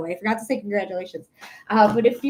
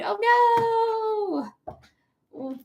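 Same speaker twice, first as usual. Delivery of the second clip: high, drawn-out mock lament; mock sympathetic wail; 11 semitones higher than usual. Streamer reacting to a sleep-deprived viewer, mock wailing.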